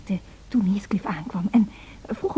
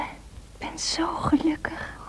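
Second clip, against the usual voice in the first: slow happy whisper; 4 semitones higher, 8 dB quieter, much slower than usual. Acted. Whispered confession of happiness.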